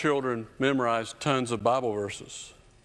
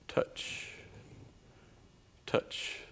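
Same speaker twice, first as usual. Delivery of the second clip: unvoiced "touch, touch"; whispered; 12 dB quieter than usual. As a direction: breathe the word touch tenderly. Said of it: "reading scripture, whispered repeat".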